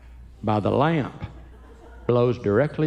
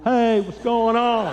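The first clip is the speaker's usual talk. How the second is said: slow, high, tight-skinned hello; slow stretched-face greeting; 7 semitones higher, slower than usual. Retold or imitated. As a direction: greet the room slowly and high. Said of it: "I'd be walking in here going".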